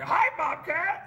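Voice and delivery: high, drawn-out puppet greeting; Grover impression. Puppet greeting the show's director, high cartoon voice. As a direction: sing out hello in a high puppet voice. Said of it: "they put Grover on, he's like".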